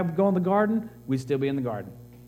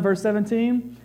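ordinary delivery

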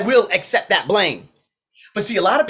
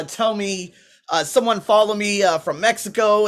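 usual speaking voice

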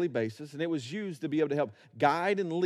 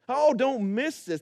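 ordinary delivery

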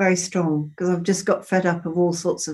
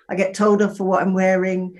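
ordinary delivery

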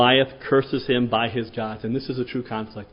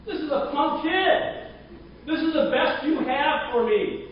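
scornful giant; slower than usual. Retold or imitated. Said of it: "he is furious".

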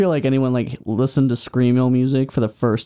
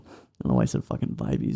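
embarrassed whisper under breath; whispered aside; 13 semitones lower, 8 dB quieter than usual. Real, not acted. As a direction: mutter it sheepishly, unvoiced. Embarrassed aside about word.

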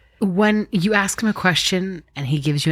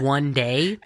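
ordinary delivery

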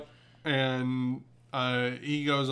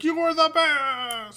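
high, slow gushing praise with a cry in it; mock gushing cheer; 13 semitones higher, slower than usual. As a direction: gush like an adoring fan. Classmates cheering Deku back.

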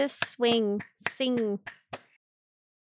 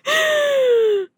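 mock gasp. Mock shock at being mocked.

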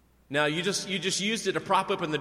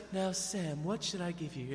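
softer, lightly voiced elf queen; a hint of a gracious elf queen giving gifts; Galadriel; 6 dB quieter than usual. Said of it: "she says".

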